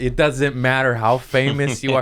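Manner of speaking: loud, high, insistent call; club enforcer. Acted. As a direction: order the star off, no exceptions. Club throwing famous comic off stage.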